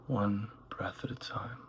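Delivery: calm meditation voice, gradually softening; unhurried phrases with long silences between them; guided meditation. Acted. Guide leading listeners through one breath at a time, guided meditation.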